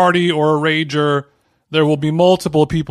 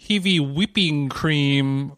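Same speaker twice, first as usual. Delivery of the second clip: slow New Zealand accent, drawn out; Kiwi accent; 5 dB quieter, slower than usual. Shown as a demonstration. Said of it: "with a Kiwi accent".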